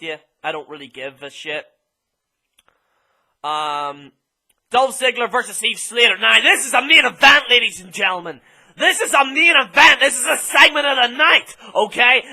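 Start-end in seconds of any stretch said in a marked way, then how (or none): 6.2-12.2 louder, higher, selling the main event; hype announcer. Real, not acted. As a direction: pump up the crowd.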